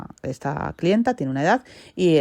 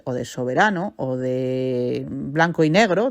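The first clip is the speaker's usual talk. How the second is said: ordinary talk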